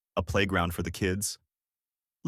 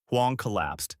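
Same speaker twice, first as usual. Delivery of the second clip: usual speaking voice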